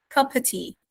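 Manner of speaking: "Cup of tea" linked as "cuppa tea"; American reduced "of". Shown as a demonstration. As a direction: glide through "Cup of tea" with no v in "of". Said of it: "We delete the off completely".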